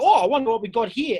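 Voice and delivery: a hint of mischievous older boys; sly older boys. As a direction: tease it like a greedy older boy. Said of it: "all the older boys were like".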